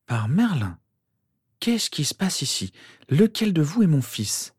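high-pitched, astonished demand; bewildered. A narrator's reading. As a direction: exclaim in confusion, voice lifted high. Bewildered mother demands an explanation.

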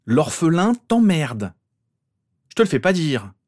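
high, loud quarrelling voice; angry retort. A narrator's reading. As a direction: throw the insult back at him. Insults traded in acted quarrel.

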